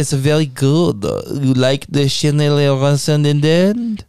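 joking botched German voice; mock German accent. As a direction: ham up a failed German accent. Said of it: "That was not German at all".

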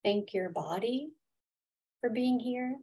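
guided meditation. Acted. Leads a gratitude meditation.

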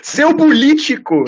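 slow, high, scornful Seu político; mocking title.

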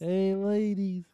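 imitation of a zany comic; Jerry Lewis. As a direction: draw it out like a goofy comedian. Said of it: "That's what he says".

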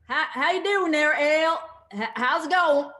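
loud, high mountain twang; imitating Appalachian friend. Imitating a friend from the barn, loud Appalachian greeting. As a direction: holler a greeting like an Appalachian local. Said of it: "that's how he talks".

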